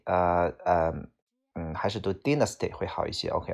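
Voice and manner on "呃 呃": usual speaking voice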